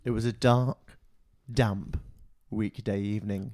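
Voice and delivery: low, slow storyteller voice; dramatic narration. Acted. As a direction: intone it like a gloomy tale. Retake of dramatic opening.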